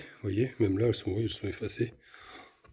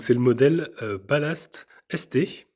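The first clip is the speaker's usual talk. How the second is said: ordinary talk, nothing marked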